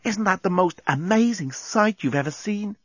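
high-pitched, awestruck woman's voice; voiced as an amazed Lucy; excited wonder. A narrator's reading. Excited Lucy admires the planet.

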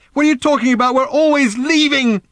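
high, loud exasperated scream; furious shout. A narrator's reading. Random exasperated with her mother, shouting furiously. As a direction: hurl it back at full pitch.

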